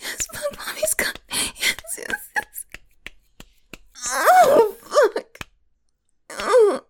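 high, loud cries that break into moans; loud climax cries. Acted. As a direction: let it spill out loud at the peak. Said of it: "I guess I got a bit loud".

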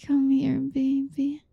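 usual speaking voice

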